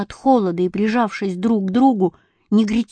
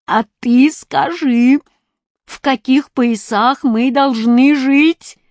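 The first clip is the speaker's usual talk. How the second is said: challenging question; 4 semitones higher, 4 dB louder, slower than usual. A narrator's reading. Animals question boy.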